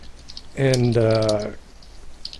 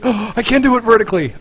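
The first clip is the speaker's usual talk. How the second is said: gasp, then high thrilled realisation; excited discovery; 9 semitones higher, 4 dB louder than usual. Real, not acted. Gamer finds a new move works, excited discovery. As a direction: gasp and blurt it high with delight. Sudden discovery while playing.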